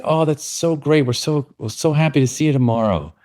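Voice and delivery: a hint of sing-song cheer; sing-song staff voice. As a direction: gush it like a pleased teacher. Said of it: "And they said".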